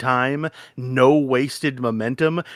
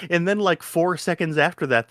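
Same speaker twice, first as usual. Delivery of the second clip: usual speaking voice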